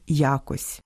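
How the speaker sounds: Я́кось, first syllable stressed. Shown as a demonstration. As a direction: say якось stressing Я. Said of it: "не тільки різні наголоси". (наголос на першому складі: я́кось)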